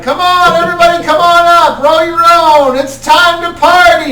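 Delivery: very high, loud, fast party call; rally everyone to the party; party stoner. Acted. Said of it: "he's like".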